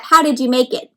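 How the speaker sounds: usual speaking voice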